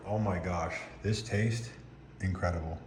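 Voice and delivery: usual speaking voice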